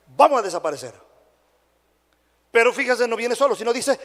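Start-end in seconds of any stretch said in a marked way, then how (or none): none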